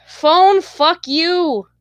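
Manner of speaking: high, loud curse at the phone; real annoyed outburst. Real, not acted. Streamer cursing at the phone, annoyed and high. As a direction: raise the pitch, cursing the phone.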